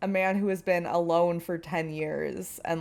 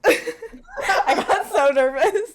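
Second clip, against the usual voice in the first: nervous laugh; 10 semitones higher, 8 dB louder, much slower than usual. Real, not acted.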